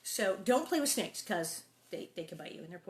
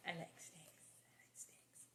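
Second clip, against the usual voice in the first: whispered confession; hushed aside to the listener; 3 semitones lower, 21 dB quieter than usual. Real, not acted. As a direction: whisper it like a secret. Confides she likes snakes.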